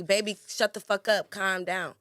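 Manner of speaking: harsh delivery. Shown as a demonstration. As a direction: order your partner to calm down, pushing the volume. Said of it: "It's all about your delivery".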